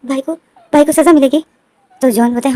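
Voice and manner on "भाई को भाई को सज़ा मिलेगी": shocked question. A narrator's reading. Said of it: "तो वही जो बोलता है".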